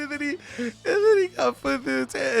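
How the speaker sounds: high, squealing words through put-on laughter; hysterical laughing. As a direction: act laughing too hard to speak. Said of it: "he keeps laughing hysterically".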